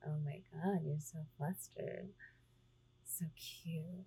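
ordinary delivery

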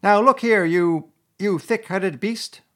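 high, loud, slowed-down scolding; angry rat at the end of patience; really angry. A narrator's reading. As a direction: berate a thick-headed friend. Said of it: "really angry".

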